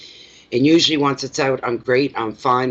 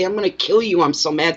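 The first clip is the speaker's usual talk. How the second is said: mock angry outburst; 7 semitones higher than usual. Reader showing how she vents, high mock fury. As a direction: ham up a fake threat. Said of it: "Oh, anger's this and anger".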